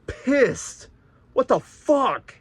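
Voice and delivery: real angry outburst on waking; pissed. Real, not acted. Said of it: "I was".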